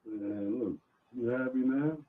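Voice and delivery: faint, far from the mic; muffled, hard to hear; barely audible. Real, not acted. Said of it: "We, we can hardly hear you".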